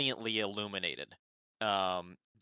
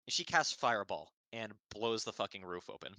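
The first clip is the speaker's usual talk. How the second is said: normal talking voice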